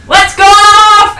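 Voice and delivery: high, loud, long-drawn cheer; re-enacted yell. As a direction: holler it like a fan at the game. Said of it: "I just started yelling whole game".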